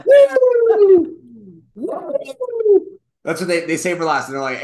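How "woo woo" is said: very high, very loud woo; hammed-up celebration whoop; whooping cheer. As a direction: yell woo like a stadium crowd. Cheering a mock standing ovation.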